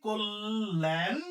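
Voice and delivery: Kalyan drawn out with a rise; slow demo. Shown as a demonstration. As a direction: stretch Kalyan to show the ending. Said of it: "শেষে থামছি".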